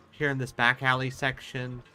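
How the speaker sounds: ordinary delivery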